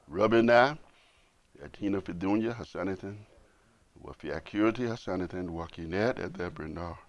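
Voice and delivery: fast, soft recited Arabic prayer; prayer recitation. Acted. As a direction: rattle off the memorized dua.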